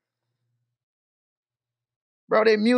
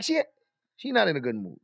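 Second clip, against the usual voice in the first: ordinary talk, nothing marked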